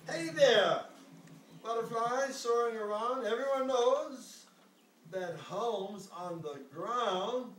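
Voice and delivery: high, loud call up to the sky. Acted. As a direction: call up to a butterfly above. Caterpillar calls to butterfly.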